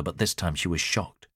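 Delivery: ordinary delivery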